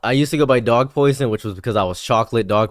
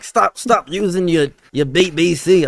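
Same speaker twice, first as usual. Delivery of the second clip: high, slowed comic pleading; mock protest; 6 semitones higher, slower than usual. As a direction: playfully act out an indignant complaint. Acts out villagers' protest.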